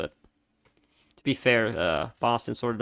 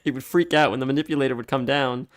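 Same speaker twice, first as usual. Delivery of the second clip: usual speaking voice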